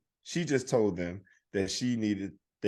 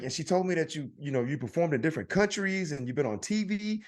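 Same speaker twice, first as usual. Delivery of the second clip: friendly salesman; 7 semitones higher than usual. Retold or imitated. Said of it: "he's like".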